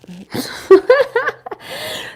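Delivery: loud, high burst of laughter; big high laugh. Real, not acted. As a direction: laugh it off, overwhelmed. Overwhelmed by tarot reading.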